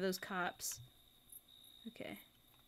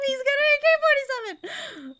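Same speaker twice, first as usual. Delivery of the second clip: much higher and louder, laughing alarm; laughing panic; 13 semitones higher, 9 dB louder than usual. Real, not acted. Player panicking at an armed character. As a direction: squeal it through laughter.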